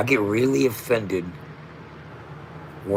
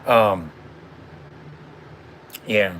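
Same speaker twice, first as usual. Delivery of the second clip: ordinary talk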